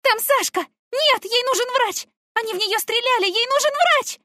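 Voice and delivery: piercing high scream. A narrator's reading. Girl dragged from her dying friend, screaming. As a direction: scream no with everything you have. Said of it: "я закричала".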